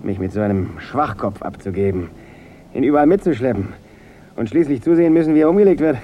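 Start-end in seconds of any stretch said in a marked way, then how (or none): 2.7-6.0 builds louder and higher, fast at the end; rising bitter outburst. Acted. Gangster Nick bursting out about his murdered younger brother, bitter rising shout. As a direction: let the grief boil over into a near shout.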